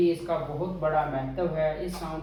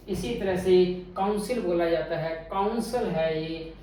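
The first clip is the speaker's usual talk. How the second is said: ordinary talk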